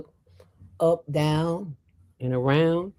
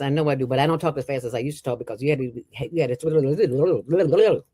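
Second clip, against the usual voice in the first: rushed radio talk; 3 semitones lower than usual. Shown as a demonstration. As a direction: race through it like an old radio host. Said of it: "did you understand what I said?".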